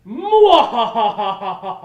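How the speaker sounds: booming slow mad scientist cackle; evil laugh. Acted. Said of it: "I'm just teasing".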